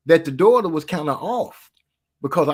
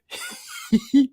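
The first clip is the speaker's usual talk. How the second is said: breathy laugh; 10 semitones higher than usual. Real, not acted. Laughs at the dad story.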